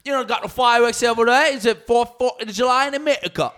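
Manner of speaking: put-on high, loud English accent; squeaky, hammed-up English questioning; mock English accent. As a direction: babble in an exaggerated English accent. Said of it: "make up with the English accent".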